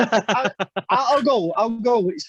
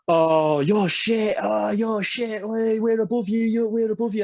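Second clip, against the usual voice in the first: mocking rival fans; 2 semitones higher, slower than usual. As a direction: jeer like a gloating lad at the bar. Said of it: "And they were like".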